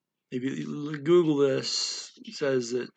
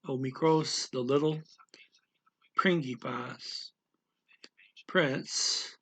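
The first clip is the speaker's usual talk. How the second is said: usual speaking voice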